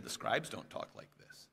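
soft whispered rumour from the crowd; hushed whisper. Acted. Townsfolk whispering to each other about Jesus, hushed. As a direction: whisper it like gossip in the street.

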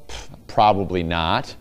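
low, scoffing brush-off after a "Pff"; dismissive scoff. Shown as a demonstration. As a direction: shrug off the rival town with a sniff. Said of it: "anything good come out of Saint Paul?".

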